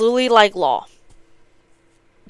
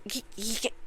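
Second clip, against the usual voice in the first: very soft mock stammer; retold panic; 17 dB quieter than usual. Gambler losing his nerve, imitated faltering. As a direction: falter under your breath, imitating disbelief. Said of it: "he's, like, tweaking".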